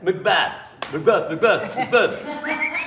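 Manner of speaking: playfully loud chant, rising at the end; mock calling out. Jokingly repeats the play's title.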